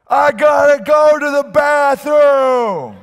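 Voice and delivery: high, slow bellow imitating deaf grandpa; deaf grandpa shouting. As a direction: announce it obliviously at the top of your voice. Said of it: "my grandpa leans over again and goes".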